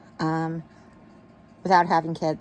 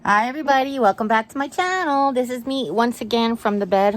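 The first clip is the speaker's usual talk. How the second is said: higher, louder upbeat channel welcome; vlog greeting; 6 semitones higher, 4 dB louder than usual. Acted. Welcoming viewers back to the channel from bed, upbeat. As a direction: greet the viewers brightly to open the show.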